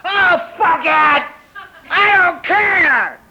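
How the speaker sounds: slow, high scream at the top of your lungs; desperate scream. Acted. At home in total desperation, a full-lunged scream. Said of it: "scream at the top of your lungs".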